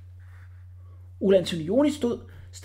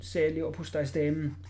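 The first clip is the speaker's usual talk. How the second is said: ordinary delivery, nothing marked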